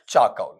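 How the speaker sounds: चाकौल low, as "chaa-kaul", no "r"; correct pronunciation. Shown as a demonstration. Teacher giving the assembled word, चाकौल without "r". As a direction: say चाकौल falling, with gliding "au". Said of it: "ऐसा निकलेगा".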